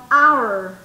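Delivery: HOUR as "our", loud, silent h; h muda. Shown as a demonstration. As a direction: open straight on the vowel of OUR. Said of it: "esa era silenciosa". (our fuerte, sin sonar la hache)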